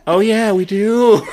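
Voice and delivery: high, drawn-out mock-innocent backpedal; suddenly sweet and high, caught in the wrong; sheepish climbdown. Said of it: "In your voice".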